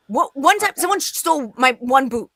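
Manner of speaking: normal talking voice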